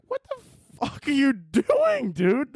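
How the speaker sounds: high, drawn-out disbelief; mock incredulous. Reacting to blood put in Nikes, mock incredulous. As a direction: stretch it out in comic shock.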